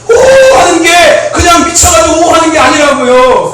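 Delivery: loud, drawn-out whoop into slow insistence; emphatic preaching. Shown as a demonstration. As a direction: open on a big high cheer, then drive the point home. Demonstrates a crazed cheer, preaching.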